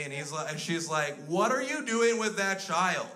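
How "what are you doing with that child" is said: high, slow, drawn-out accusation; shocked busybody voice pitched up; alarmed Karen. Acted. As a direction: challenge him like an appalled bystander. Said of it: "Karen comes up to me".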